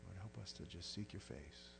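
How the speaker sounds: soft earnest pastor's prayer; closing prayer. Acted. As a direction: pray it gently over the congregation.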